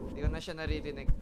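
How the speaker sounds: ordinary delivery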